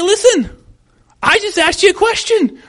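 high, loud, defensive comeback; hammed-up exasperated wife; prideful wife's retort. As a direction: act out an indignant wife standing her ground. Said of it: "she might say".

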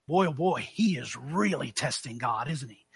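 low, soft, knowing murmur; hushed aside. Real, not acted. Preacher to congregation on testing God, hushed aside. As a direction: drop low and quiet in disbelief. Aside marvelling at the prophet's nerve.

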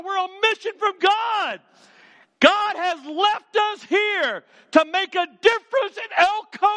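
preaching at full force. Acted. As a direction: lift the whole room, shouting the call.

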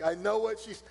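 ordinary talk, nothing marked

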